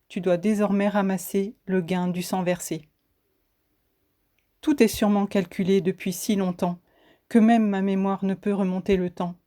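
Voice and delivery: ordinary talk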